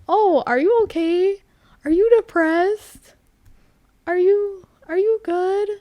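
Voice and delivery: high, loud imitation of a fussing friend; mock concern. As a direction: pester with exaggerated, high-pitched worry.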